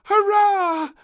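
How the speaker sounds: very high, loud hurrah; cheering. A narrator's reading. Princess cheering at sandwiches, loud high. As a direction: cheer with joy. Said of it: "cried little princess".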